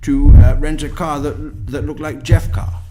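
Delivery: mild mock imitation of a gullible fan; mocking simp voice. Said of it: "and say".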